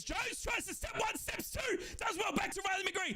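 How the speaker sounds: high, urgent attack commentary; excited play-by-play. Real, not acted.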